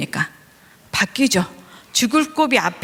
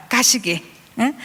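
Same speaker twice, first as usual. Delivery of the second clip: ordinary delivery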